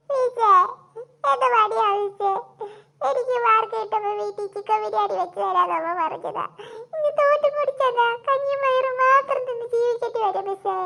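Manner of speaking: hammed-up blubbering plea; crying student. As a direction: wail and beg through mock sobs. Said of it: "എടാ, കരയില്ലടാ".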